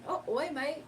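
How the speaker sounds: put-on Australian accent. Answering a chat viewer, in a mock Australian accent. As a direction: toss off a cheerful Australian "oi, mate". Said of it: "I'm Australian".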